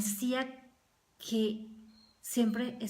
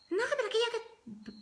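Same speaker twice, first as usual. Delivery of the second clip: much higher, squeaky mocking complaint; mock snappy retort; 13 semitones higher than usual. Preacher mimics a rude spouse's retort, high mocking squeak. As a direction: parody someone answering back rudely.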